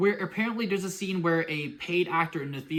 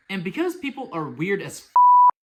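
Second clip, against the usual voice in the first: exasperated outburst; 2 semitones higher, 10 dB louder than usual. Real, not acted.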